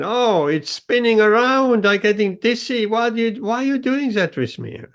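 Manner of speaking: dizzy complaining clay. Acted. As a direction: moan like clay getting dizzy on the wheel. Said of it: "complained again".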